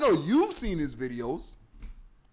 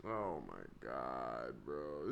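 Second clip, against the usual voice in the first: low, slow, soft disbelief; exasperated groan; 13 dB quieter, much slower than usual. Real, not acted. Cohost reacting to Shane's series, exasperated low drawl. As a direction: drag it out, fed up.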